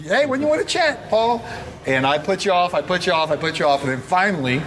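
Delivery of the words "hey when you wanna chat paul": much higher, hopeful nudge; persistent check-in. Retold or imitated. Coach nudging Paul again about a chat, high and hopeful. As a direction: ask again, brightly and hopefully. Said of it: "the next month".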